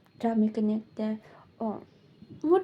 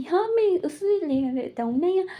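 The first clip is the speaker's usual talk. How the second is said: higher, mock angry yell; retold yelling; 6 semitones higher than usual. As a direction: snap back at the husband, yelling. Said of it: "चिल्लाने लगते हैं". (पति पर चिल्लाते हुए, हल्का नकली गुस्सा)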